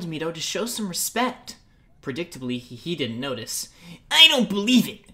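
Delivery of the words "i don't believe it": higher, louder, spitting disbelief; spat out. Acted. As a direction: spit the words at them. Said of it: "Mito spat".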